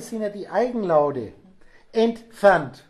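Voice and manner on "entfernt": much louder, one hammered word; emphatic. Real, not acted. Teacher calling out the key step to his class, loudly. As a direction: land the point with a loud single word.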